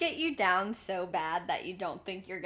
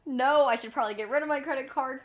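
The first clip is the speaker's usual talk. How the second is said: higher, louder and fast, fed up; fed-up self-quote, higher and faster; 7 semitones higher, 6 dB louder, faster than usual. Retold or imitated. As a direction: rush it out in mock exasperation. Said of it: "I'm actually like".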